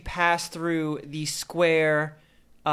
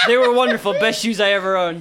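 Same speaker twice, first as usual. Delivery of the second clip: laughing mock lament; 5 semitones higher, 7 dB louder than usual.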